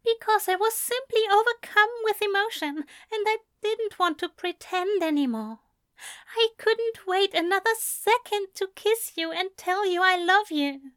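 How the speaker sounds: high, tender angel voice praising; doting angel. A narrator's reading. Loving angel praises Crowley between kisses, high and doting. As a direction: gush with affection between kisses.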